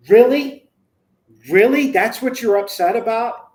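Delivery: loud, high-pitched disbelieving retort; acted disbelief, punched out loudly; incredulous. Father whipping round to confront upset teen, incredulous.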